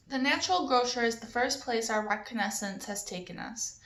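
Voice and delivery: usual speaking voice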